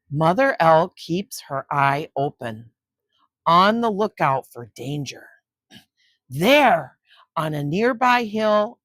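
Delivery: put-on expressive read-aloud narration for kids; story reading. A narrator's reading. Grandma reading a nature book to children, storytelling voice. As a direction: read it like grandma at bedtime, with suspense.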